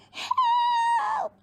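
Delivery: high squeaked cry for help; panicked shrill squeak of a tiny gnome. Acted. Campion squeaks in panic at the giant. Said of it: "squeaked Campion".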